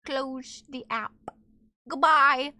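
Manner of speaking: normal talking voice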